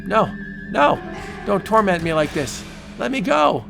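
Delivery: high, desperate cries; anguished pleading. Acted. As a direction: plead in torment. Tormented prisoner.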